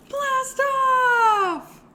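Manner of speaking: high, loud, drawn-out cheer; launch shout. Pretend. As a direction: shout it like a rocket taking off. Second rocket countdown launch.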